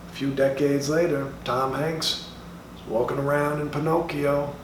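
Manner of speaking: ordinary talk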